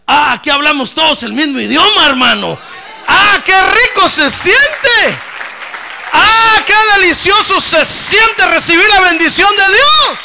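loud, high, drawn-out praise; preaching at full force. Real, not acted. Pastor to congregation, full-force ecstatic praise.

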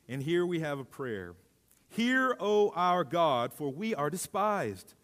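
lifted, measured Bible reading; a public scripture reading voice. Acted. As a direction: read the passage to the church with weight. Reads Nehemiah aloud to congregation.

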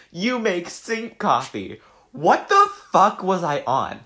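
high, loud, slow outraged shout; loud disbelief. Real, not acted. Reacting loudly to her old sink coffee caption. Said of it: "horrified at own caption".

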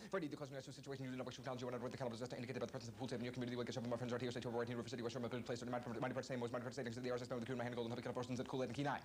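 machine-gun fast patter of a record holder; fast-talking demo. Shown as a demonstration. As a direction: rattle it off at breakneck speed. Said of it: "can you give us a little bit of that".

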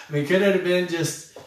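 ordinary delivery

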